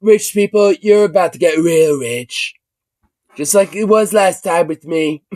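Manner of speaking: a hint of a pompous president; lightly voiced smug politician; parody president. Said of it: "like, you know".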